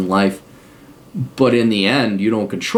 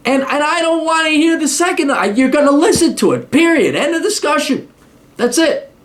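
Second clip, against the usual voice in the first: much higher, mock furious tirade at a son; in his face; 14 semitones higher than usual. Shown as a demonstration. A father bullies his son into listening to a book. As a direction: lay down the law, hammed-up rage. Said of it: "Get, get in his face".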